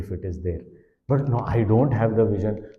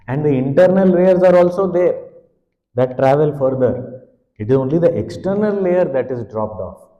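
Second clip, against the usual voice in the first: raised, louder sermon on the eternal self; swelling teacher's fervour; impassioned preaching; 5 semitones higher, 7 dB louder than usual. Acted. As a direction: proclaim it with conviction. Impassioned point about eternal self.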